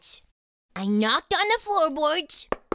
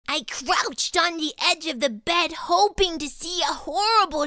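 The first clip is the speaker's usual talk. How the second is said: ordinary delivery, nothing marked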